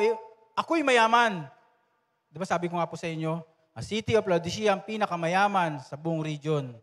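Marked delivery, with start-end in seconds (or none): 0.0-1.5 mock boast. Pastor voices the boastful church.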